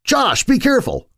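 high, alarmed call; urgent warning. Acted. Warning Josh as the raft falls apart, alarmed. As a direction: warn him sharply as the raft breaks.

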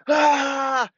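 a short, high, mock frustrated yell; held-back yell. Surfer frustrated in the water, short mock yell. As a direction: let out a half-strength wild-man cry, holding back for the mic. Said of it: "You know, I would yell for real".

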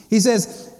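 ordinary delivery

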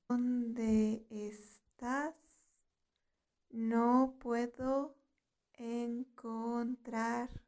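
very slow, with "¿Dónde estás? No puedo encontrarte." broken into small pieces; slow teaching repetition. Shown as a demonstration. Teacher has learners repeat "¿Dónde estás? No puedo encontrarte." after her, very slowly. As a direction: draw out each word of "¿Dónde estás? No puedo encontrarte." with pauses.

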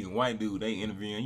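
usual speaking voice

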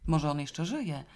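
ordinary delivery

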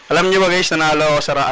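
loud, eager announcement; excited. Real, not acted. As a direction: burst out with big news. Announcing he won a contest.

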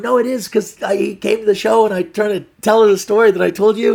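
high, urgent mock protest; flustered pleading. Boyfriend explaining the story to angry girlfriend, flustered. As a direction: plead loudly over her disbelief. Pleading his case in acted quarrel.

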